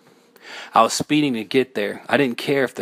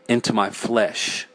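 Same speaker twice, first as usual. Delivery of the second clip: usual speaking voice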